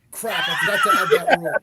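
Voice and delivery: high, loud fake panic; mock outburst. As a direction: squeal it out in mock dismay. Mock self-scolding.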